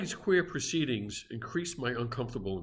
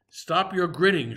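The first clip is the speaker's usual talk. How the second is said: higher, louder angry shout; 5 semitones higher, 6 dB louder than usual. A narrator's reading. Narrator shouts at the landlord to stop grinning, angry. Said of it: "shouted I".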